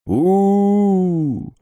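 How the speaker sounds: loud high hoot into a well; shouting down a well. A narrator's reading. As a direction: call out through cupped hands. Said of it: "сложив ладони рупором, крикнул в колодец".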